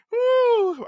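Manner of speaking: high-pitched amused "woo"; high laughing whoop. Real, not acted. Host winding down from his own joke, amused whoop. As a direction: let out a whoop after laughing.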